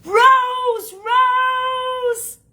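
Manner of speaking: high, loud, drawn-out scream of a name. Acted. Cousin comes down to Rose, screaming her name. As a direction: scream it down the stairs, stretching the vowel. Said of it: "he comes down screaming".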